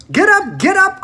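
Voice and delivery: high, loud, gleeful cry; roaring cry. A narrator's reading. Mr Wonka calling to tumbling passengers, loud and gleeful. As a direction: bellow it merrily at the fallen guests. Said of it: "roaring with laughter".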